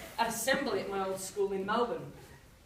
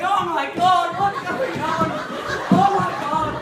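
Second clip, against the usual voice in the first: laughing panic; 7 semitones higher, 11 dB louder, slower than usual. Retold or imitated. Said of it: "I was on the floor going".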